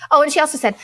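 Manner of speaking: normal talking voice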